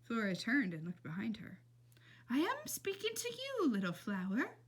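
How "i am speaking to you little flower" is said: put-on old lady voice. A narrator's reading. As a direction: croon it like a sweet old lady. Old lady addresses Flora.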